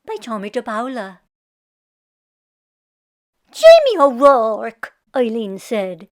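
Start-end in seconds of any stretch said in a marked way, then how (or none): 3.5-4.9 loud, slow call of his name; a wife hollering her lazy husband's name. Acted. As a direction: call him awake from outside.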